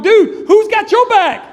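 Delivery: high, loud, drawn-out disbelief; acted exasperated challenge; incredulous retort. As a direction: throw the question back in mock outrage. Incredulous retort to small guard.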